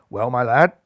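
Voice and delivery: put-on old Hoban praising Rajban, beaming. A narrator's reading. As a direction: speak like a proud old mentor. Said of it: "beamed old Hoban".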